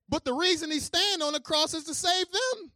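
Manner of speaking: high, loud preaching. Acted. Pastor driving home the irony to the congregation, full-force preaching.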